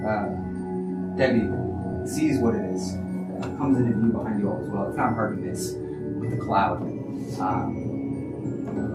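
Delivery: ordinary delivery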